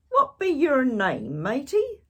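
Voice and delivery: loud, high pirate question; booming pirate query. Acted. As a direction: ask it like a friendly pirate captain. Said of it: "the head pirate asked".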